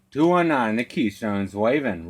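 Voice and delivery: ordinary talk, nothing marked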